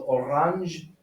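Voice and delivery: "orange" as o-RAHNZH, open "ah"; French-style G. Shown as a demonstration. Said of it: "still pronounce that G like".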